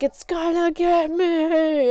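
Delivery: slow, high, loud panicked cry; terrified child screaming at a doll; retold scream. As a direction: yell it like the doll is coming for you. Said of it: "And I just kept screaming".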